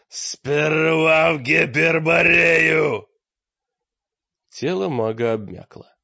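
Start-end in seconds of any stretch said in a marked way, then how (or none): 0.1-3.1 loud, drawn-out, high roar; roaring growl. A narrator's reading. Мрак roars his demand at the throttled mage. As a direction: roar it slowly, stretching every word. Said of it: "Прорычал, почти путая слова и волчий рык".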